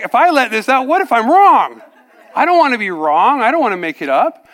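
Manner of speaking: loud, high-pitched mock panic; acted protest. Man afraid of faking it before his pastor, acted panicky protest. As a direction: blurt out doubts at the top of the voice.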